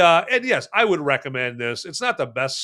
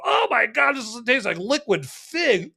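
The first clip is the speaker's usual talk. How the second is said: mock disgusted shock; 9 semitones higher than usual. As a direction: ham up a shocked first sip. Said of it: "drink off this thing and go".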